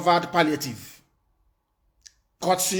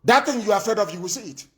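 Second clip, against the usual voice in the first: ordinary talk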